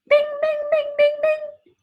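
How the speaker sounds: high pinging alarm bell, slow; mock alarm going off; alarm bell bing. Shown as a demonstration. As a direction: ring out like a warning bell. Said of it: "Little red bell going off".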